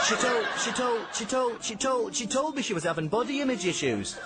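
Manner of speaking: Stevie's stammer turning rude; stuttering Stevie. Acted. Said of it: "who only ever stuttered".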